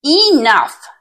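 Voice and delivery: a loud, high roar of Enough. A narrator's reading. Mama Bear stopping her wandering cub with a loud roar. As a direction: bellow it to end the argument.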